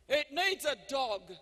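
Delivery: slow loud retold yell. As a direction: call it out across the room. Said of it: "yells out at the top of his voice".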